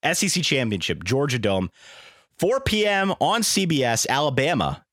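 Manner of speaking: congested sick voice; stuffy nose. Real, not acted. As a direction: talk through a blocked nose. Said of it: "I'm sick".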